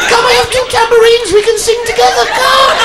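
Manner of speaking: rejoice like a revival convert; ecstatic preacher. Pretend. Comic answering the carpet man, wildly ecstatic and preachy. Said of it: "I should have just gone".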